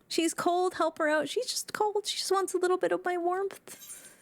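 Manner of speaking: playful high-pitched coo; high teasing voice. Pretend. Teasing about a cold character.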